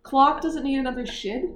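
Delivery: baffled, rising question; puzzled echo. Real, not acted. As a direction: repeat it back in real bafflement.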